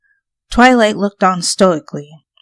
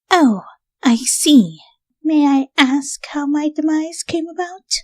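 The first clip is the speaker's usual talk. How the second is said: composed Rarity; 9 semitones higher than usual. A narrator's reading. Revived Rarity asks her friends how she died. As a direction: ask with poise.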